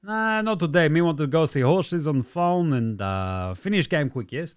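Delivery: lightly put-on broken-English accent, mocking Jokic. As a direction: play Jokic brushing off the game. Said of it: "Jokic just went".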